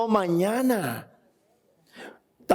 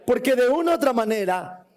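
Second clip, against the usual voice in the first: ordinary talk, nothing marked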